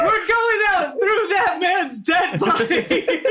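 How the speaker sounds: high, drawn-out mock threat through laughter; gleeful battle cry. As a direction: declare it with hammed-up bravado, laughing. Player cheers on a wild plan.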